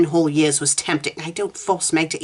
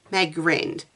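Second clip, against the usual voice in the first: ordinary delivery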